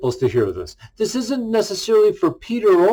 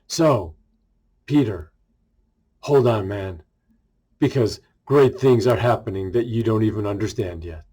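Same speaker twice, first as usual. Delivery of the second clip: tender reassurance; 6 semitones lower than usual. Acted. Jesus reassuring Peter, low and tender.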